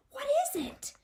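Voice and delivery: very high breathless alarm; startled. Acted. Startled mother asking her daughter, high and alarmed. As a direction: gasp it out, frightened.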